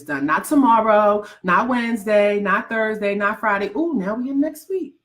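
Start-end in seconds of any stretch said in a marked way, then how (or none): 3.8-5.0 high, incredulous scolding "what happened?"; mock exasperated scolding. Coach mock-scolding viewers who put off their goals, high and incredulous. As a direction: playfully tell off the procrastinators in a raised voice.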